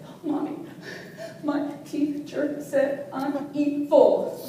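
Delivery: slow, halting hurt child voice, acted; tearful child. Daughter home from school crying to Mommy, acted tearful. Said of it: "came home from school crying".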